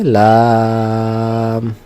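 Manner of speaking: লাম held long on one even note; tongue tip to upper gum, then stretch লাম; drawn-out letter name. Shown as a demonstration. Teacher shows where লাম is articulated, drawn out. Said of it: "উপরের দাঁতের মাড়ির সঙ্গে লাগিয়ে".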